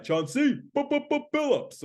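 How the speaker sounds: high, drawn-out stuttering arena call; stretched, high-pitched player introduction; cartoonish PA announcer. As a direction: announce it like a cartoon hype man. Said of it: "he would be like".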